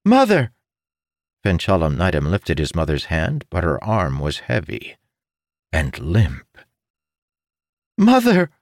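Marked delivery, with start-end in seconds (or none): 0.0-0.5 very high, loud desperate cry; desperate shout. Acted. Son shouting at his dead mother, desperate. Calling louder. 8.0-8.5 very high, loud desperate cry; desperate shout. Acted. Son shouting at his dead mother, desperate. Calling louder.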